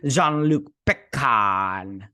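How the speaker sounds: mocking, very slow "Jean-Luc Picard"; Amanda Plummer. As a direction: stretch out "Jean-Luc Picard", leaning hard on the last syllable. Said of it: "stop saying it like that".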